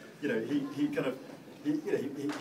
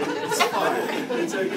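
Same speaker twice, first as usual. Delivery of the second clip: laughing; 7 semitones higher, 8 dB louder, slower than usual. Retold or imitated. Dismissing father, laughing.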